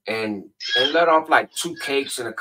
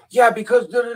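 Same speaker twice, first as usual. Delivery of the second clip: high, loud blah-blah parody of the rant; mock rant; 11 semitones higher, 7 dB louder than usual. Narrator mocking the drunk neighbor's complaint, high and loud.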